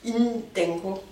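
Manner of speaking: intenko with the N breathed out clearly; careful intenko, N pushed out; aspirated n. Shown as a demonstration. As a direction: repeat intenko, marking the aspirated N. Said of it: "c'est pas itenko".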